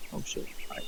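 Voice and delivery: whispered aside, caught off guard; startled whisper. Real, not acted. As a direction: breathe it under your breath, surprised.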